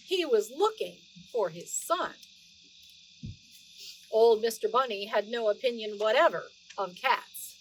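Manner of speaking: lively read-aloud storytelling voice; story narration. Acted. Grandma reading Beatrix Potter to the children, animated storytelling voice. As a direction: read it like a bedtime story for little ones.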